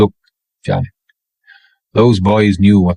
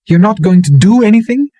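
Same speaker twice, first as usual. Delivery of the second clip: high, loud disbelieving woman; high-pitched voice, louder, in disbelief; incredulous woman; 12 semitones higher, 4 dB louder than usual. A narrator's reading. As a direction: she can't believe he'll do nothing. Incredulous woman questions him.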